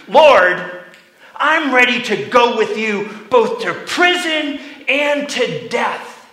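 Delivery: fervent, loud declaration of loyalty; bold vow. Acted. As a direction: Peter blurting out his loyalty.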